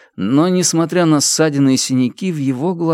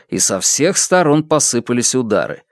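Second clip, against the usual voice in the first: ordinary delivery, nothing marked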